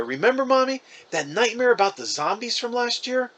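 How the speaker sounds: put-on high childlike voice for the little brother.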